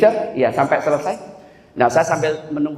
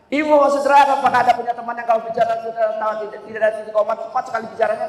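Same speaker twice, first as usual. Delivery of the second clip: high, run-on rush of words; rapid, unpunctuated speech; 6 semitones higher, faster than usual. Shown as a demonstration. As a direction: rattle it off without commas or full stops. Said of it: "Bicaranya nggak ada titik koma".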